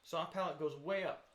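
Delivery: ordinary talk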